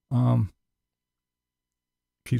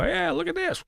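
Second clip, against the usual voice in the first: much higher, goofy mock voice; mocking Tim. Mocking Tim poking meat at Walmart. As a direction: parody him showing off meat. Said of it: "and then go".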